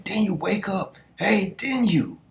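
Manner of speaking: high, pleading questions; mock tearful anime plea. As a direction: beg him to wake, slowly. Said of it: "they still saying".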